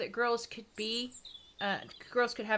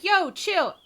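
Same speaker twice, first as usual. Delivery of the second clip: higher, louder mock slang "Yo, chill"; mock slangy mom; 7 semitones higher, 6 dB louder than usual. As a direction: toss off "Yo, chill" like street slang. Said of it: "she didn't talk like that".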